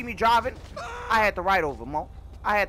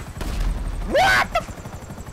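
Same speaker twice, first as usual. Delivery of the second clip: stunned gasp; much slower than usual. Real, not acted. Helicopter fails in game.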